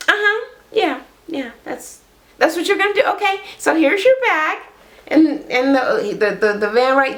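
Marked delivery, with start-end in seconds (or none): 0.1-4.6 high, sugary mock politeness; fake-sweet girlfriend. Girlfriend cheerfully handing her boyfriend his bag, high and fake-sweet. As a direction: sweetly show him the door.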